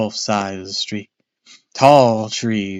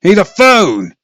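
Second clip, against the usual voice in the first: frantic shout; 7 semitones higher, 9 dB louder than usual. Acted. Dad frantic for phone.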